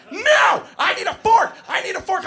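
piercingly high, loud demand; screaming child. Retold or imitated. Preschooler repeats demand to teacher, screaming. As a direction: shriek like a three-year-old in a tantrum. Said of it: "I'm being screamed at point blank".

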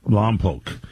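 Lompoc repeated as "Lom-poke"; correct Lompoc pronunciation. Shown as a demonstration. Host echoes guest's correct way, Lompoc ending in "poke". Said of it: "Say poke and you got it right".

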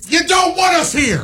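mock protest. Real, not acted. Mock quarrel, co-host protesting.

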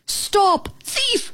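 eager dog yelling at the bug; dog's bark. Acted. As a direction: bark the command like an excited dog. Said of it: "Max barked".

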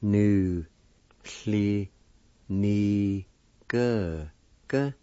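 slow, each syllable held and followed by a pause. Shown as a demonstration. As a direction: sound it out one syllable at a time. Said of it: "needs to pronounce like this".

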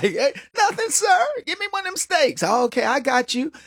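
high, easygoing banter; playful chat over the grill; casual barbecue chat. Retold or imitated. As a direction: ask for a steak like a pal. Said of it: "I'm like".

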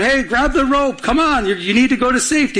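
high-pitched yell, no real volume; mock yell. Helicopter rescuer calls to man on the roof, mock yell. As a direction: imitate calling down from a helicopter. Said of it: "yells down".

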